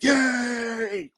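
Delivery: cheering yay, voice pushed up; mock cheer. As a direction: mimic the crowd cheering. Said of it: "They gonna be like".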